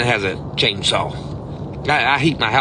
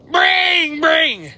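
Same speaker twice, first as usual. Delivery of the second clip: phone ringing imitation; 12 semitones higher than usual. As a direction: chant it like a desperate ringing phone. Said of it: "They sound desperate".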